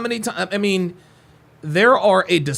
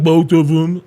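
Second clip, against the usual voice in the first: mocking evasive answer; 2 semitones lower, 6 dB louder, slower than usual. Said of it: "You ain't got to be like".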